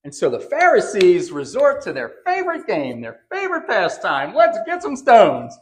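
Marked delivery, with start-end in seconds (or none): 4.3-5.6 high, loud, slow rallying cry; mock call to arms. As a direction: rouse the crowd to grab stones. Pharisees turn to stoning.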